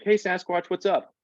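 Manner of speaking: put-on high-pitched kids calling out; imitated kids' greeting. Kids greeting the speaker on campus, high and casual. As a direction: mimic kids hailing a favourite visitor, high voice.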